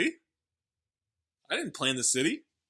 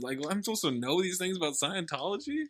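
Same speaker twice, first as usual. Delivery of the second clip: ordinary talk, nothing marked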